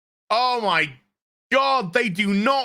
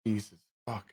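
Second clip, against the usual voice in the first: ordinary delivery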